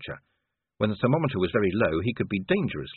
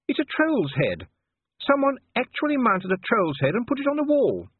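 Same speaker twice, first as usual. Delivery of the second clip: appalled lady; 3 dB louder than usual. A narrator's reading. Sybil appalled at the mounted troll head, high and shocked. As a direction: rise in shocked disbelief.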